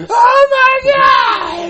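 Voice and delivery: drawn-out, high, very loud scream; screamed shock. Real, not acted. Player hit by a jump scare, screaming. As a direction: scream the words at a sudden fright.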